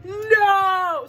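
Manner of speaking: high, loud dramatic no; hammed-up cry of despair; anguished villain cry. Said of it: "and he's like".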